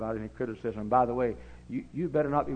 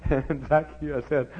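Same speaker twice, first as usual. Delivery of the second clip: chuckling through the words; laughing; 3 semitones higher, 3 dB louder, much slower than usual. Real, not acted. Preacher laughing while telling of Zacchaeus. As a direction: laugh as you tell it.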